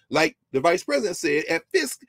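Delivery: ordinary talk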